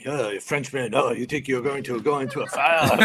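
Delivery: mock-taunting a rookie; laughing mockery of the Frenchman. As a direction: needle him like a bully. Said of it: "making fun of, um, uh, Gideon".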